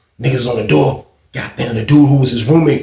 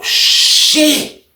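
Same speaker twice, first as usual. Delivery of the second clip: whispered shock; 13 semitones higher than usual. Retold or imitated. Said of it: "I was like".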